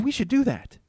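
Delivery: high-pitched eager wife imitation; excited proposal. Wife proposing a scheme to husband, high excited imitation. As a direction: pitch a bright idea in a high voice.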